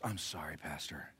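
very soft, low sheepish whisper; whispered apology. Retold or imitated. Man who swore apologizes to the pastor, whispering. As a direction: mimic a hushed, embarrassed apology.

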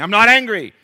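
high comic shout of denial. Acted. Angry man denying his anger, hammed-up yelling. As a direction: burst back into a hammed-up yell. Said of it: "Why are you raising your voice?".

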